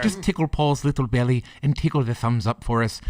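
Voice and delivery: lilting Irish leprechaun voice; playful Irish lilt, coaxing. Pretend. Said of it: "I don't know what you're doing over there".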